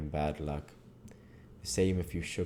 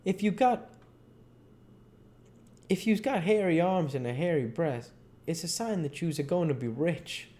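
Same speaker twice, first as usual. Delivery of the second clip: raised, lively dialect voice; Jim; 10 semitones higher than usual. Acted. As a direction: explain the sign like it's plain wisdom. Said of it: "And he said".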